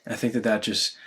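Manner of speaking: ordinary talk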